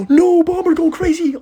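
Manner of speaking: high, loud urgent cheering; root for it at the top of your voice; squealing at the screen; frantic cheer. Real, not acted. Cheering his Bomber on.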